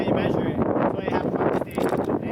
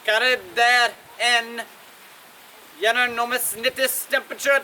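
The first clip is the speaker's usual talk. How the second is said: mistaken Italian accent; 3 semitones higher than usual. Shown as a demonstration. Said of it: "That was an Italian accent".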